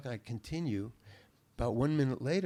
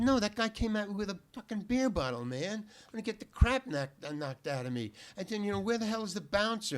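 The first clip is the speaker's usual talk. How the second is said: high-pitched, alarmed complaint; agitated protest; 10 semitones higher, 4 dB louder than usual. Retold or imitated. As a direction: plead your case in a mock panic. Retelling his panicked complaint to bartender.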